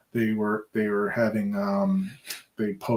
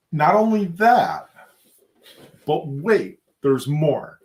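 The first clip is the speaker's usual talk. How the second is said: infomercial pitch; 5 semitones higher, 6 dB louder, slower than usual. Acted.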